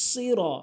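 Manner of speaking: "সিরা" high, with emphatic s and rounded "ro"; heavy sad. Shown as a demonstration. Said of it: "সতের উচ্চারণ করতে হবে".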